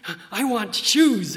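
loud, slow, goofy ask; mock silly request. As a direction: ham up a silly wish.

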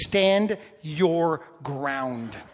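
drawn out word by word; very slow, each word given weight; slow, emphatic. Real, not acted. Preacher defining a military term for the congregation, slow and emphatic. Stressing a military term's meaning.

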